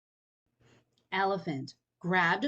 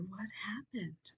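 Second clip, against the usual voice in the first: whisper; 11 dB quieter, slower than usual. Acted. Reader hushing the story's mystery for kids. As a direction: drop to a hush on the last words.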